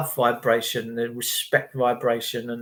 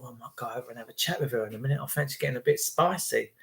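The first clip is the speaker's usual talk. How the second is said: much softer quick private musing; under-the-breath plotting; inner thought; 3 semitones lower, 9 dB quieter, much faster than usual. Retold or imitated. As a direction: think it to yourself, fast and hushed. Lad's inner thought in club.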